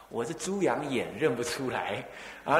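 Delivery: ordinary talk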